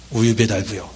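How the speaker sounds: put-on low, soft child's confession; reluctant answer. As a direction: answer quietly, pitched well down. Reluctant child answers pressing teacher.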